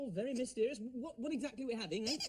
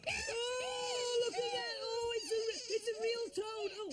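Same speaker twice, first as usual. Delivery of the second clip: high shouted shock at a real toad; 12 semitones higher, slower than usual. Real, not acted. Host finds a real toad under the lid, shouting in shock. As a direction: yelp it out, startled and disgusted.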